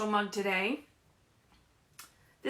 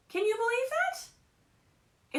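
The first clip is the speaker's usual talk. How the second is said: much higher, slow, amazed question; mock amazement; 13 semitones higher, much slower than usual. Real, not acted. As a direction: sound delighted for the little ones. Marvels to kids at phone.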